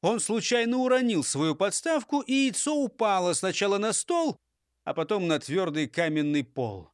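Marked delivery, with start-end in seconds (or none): none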